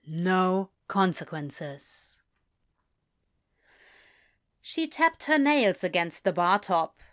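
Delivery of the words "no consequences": slow, falling confirmation; confirming answer. Acted. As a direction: confirm it very slowly, letting it drop.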